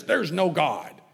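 mocking a smug atheist, firm and falling; emphatic confident claim. Preacher mimicking an atheist's confident claim, firm. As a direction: declare it as if beyond question. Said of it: "with great emphasis and great confidence".

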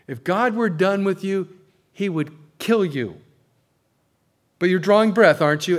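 put-on preacher telling a weeping young man he's still alive; emphatic. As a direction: press the point hard on him.

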